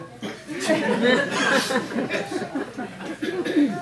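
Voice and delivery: drawn-out high laughter; long high laugh. Real, not acted. Preacher laughing at his own joke about not being a woman, long and high. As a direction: let a long laugh roll out.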